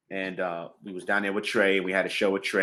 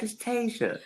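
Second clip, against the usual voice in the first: high, soft breathy little hello; 11 semitones higher, 7 dB quieter than usual. Retold or imitated. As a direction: imitate her tiny soft voice. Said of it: "I'm trying to do her voice".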